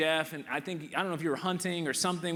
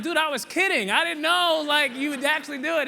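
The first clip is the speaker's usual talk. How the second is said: surprised disbelief; 13 semitones higher, 10 dB louder than usual. Retold or imitated. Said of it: "I was like".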